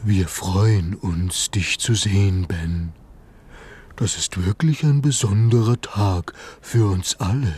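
soft as suede, a gentle giant welcoming. Acted. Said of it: "mit einer Stimme weich wie Wildleder".

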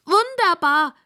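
high, delighted piggy squeal; high, overjoyed pig voice. A narrator's reading. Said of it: "quiekt Rosamunda".

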